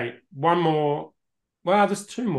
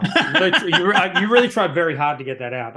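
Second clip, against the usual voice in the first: laughing; 3 semitones higher, 5 dB louder than usual. Real, not acted. Laughing at friend's Spanish attempt.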